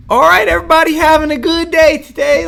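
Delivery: high, loud twangy Midwest greeting; exaggerated Midwest accent. Podcaster mimicking John Krasinski's Midwest twang, exaggerated Midwest accent. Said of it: "so distinctively has that Midwest".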